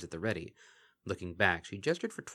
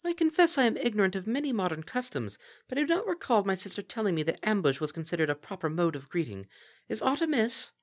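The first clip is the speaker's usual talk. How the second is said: high, formal archaic royal voice; regal Princess Luna; 12 semitones higher, 4 dB louder than usual. Acted. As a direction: speak with puzzled majesty.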